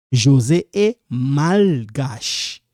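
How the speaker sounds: "malgache" slow and split, with a long l: mal-gache; drawn-out pronounced L. Shown as a demonstration. Teacher modelling the sentence for learners, slow emphatic L. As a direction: stretch "José est malgache", leaning on the l of "malgache". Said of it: "Because this L, it's pronounced".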